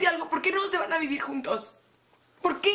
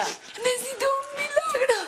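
slow, higher sobbing cry; crying plea; 7 semitones higher than usual. Acted. Sobbing to her friends for a miracle. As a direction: beg for a miracle through tears.

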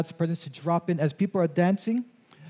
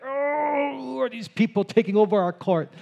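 hammed-up outraged protest; mock angry; 7 semitones higher than usual. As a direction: imitate annoyed basketball players kicked off their court. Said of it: "They come out angry, like".

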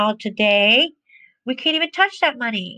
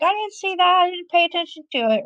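feigned innocence; 7 semitones higher, much faster than usual. Retold or imitated. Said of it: "You just kinda ignore, like".

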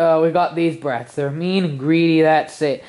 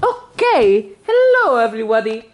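high-pitched, hammed-up Italian accent; Mario; 5 semitones higher, 4 dB louder than usual. Mario greets the audience in an Italian accent. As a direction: greet everyone in a cartoon Italian voice. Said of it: "stop doing the Italian voice".

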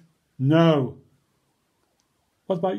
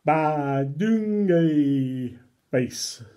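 silly drawn-out word; much slower than usual. Acted. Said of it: "make silly sounds like".

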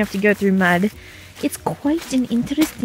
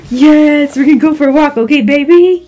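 talking to a dog; 14 dB louder than usual. Real, not acted. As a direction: hype the dog up for a walk.

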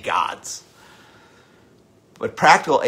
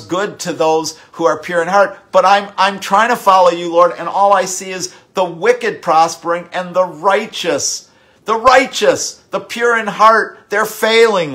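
higher, louder, crying out to the Lord; preaching at full force; 8 semitones higher, 10 dB louder than usual. Acted. Preacher voicing the psalmist's protest to God, impassioned and loud. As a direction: pour out the complaint, pleading and loud.

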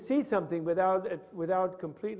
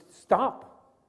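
drawn-out slow stop; 5 semitones higher than usual. Shown as a demonstration. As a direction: stretch out the word stop slowly. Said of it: "in a protective thing".

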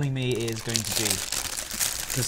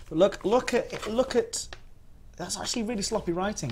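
excited; 8 semitones higher than usual. Real, not acted. Excited about dad's handwriting.

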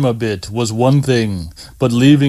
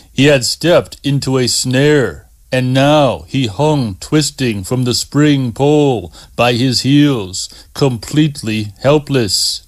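plain reading voice

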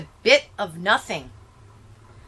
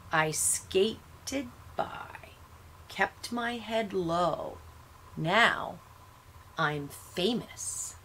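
1 quiet; 11 dB quieter, slower than usual. A narrator's reading. Dead boy's bitter reflection.

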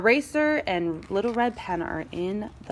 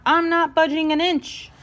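high, raised refusal; snapped; 7 semitones higher, 4 dB louder than usual. A narrator's reading. Ruler refuses to move, snapping. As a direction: refuse point-blank, indignant. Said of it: "Ruler snapped".

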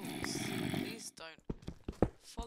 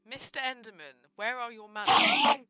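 louder mock-stern telling off; mock scolding; 2 semitones higher, 13 dB louder than usual. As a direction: scold it like a prim teacher. Scolding the Enderman.

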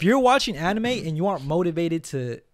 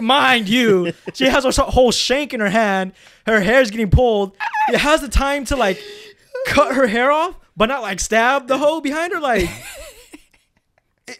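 heated rant; 7 semitones higher, 6 dB louder than usual. Real, not acted.

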